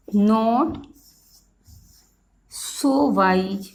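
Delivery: each word set apart by long pauses; word-by-word dictation. Shown as a demonstration. Teacher dictating example sentence, word by word. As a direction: dictate slowly for copying. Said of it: "teacher dictating example sentence".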